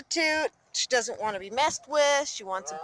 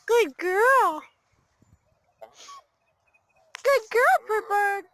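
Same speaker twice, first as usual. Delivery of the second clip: high praise; 11 semitones higher, 4 dB louder, slower than usual. Real, not acted. Owner praising her bird, high and lilting.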